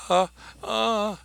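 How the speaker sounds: tiny high whimpering uh; mousy little voice. Retold or imitated. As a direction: squeak it out like a terrified girl. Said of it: "in a mousy little voice".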